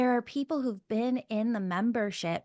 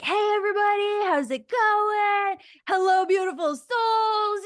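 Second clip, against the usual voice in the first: high, loud, hammed-up hello to the room; loud extrovert greeting; 10 semitones higher, 6 dB louder than usual. Imitating a loud actor for Elle, high shouted greeting. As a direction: burst in like you own the room, shouting hi. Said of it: "loud and taking up space".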